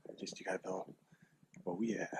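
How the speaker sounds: hushed hunter whisper. Real, not acted. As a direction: whisper so the deer can't hear. Whispering while stalking deer.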